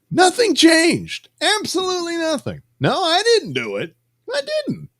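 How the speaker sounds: very high, indignant denial; high-pitched protest. Pretend. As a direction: squeak out your innocence. Insisting innocence, mock defensive.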